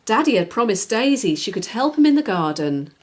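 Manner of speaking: ordinary delivery, nothing marked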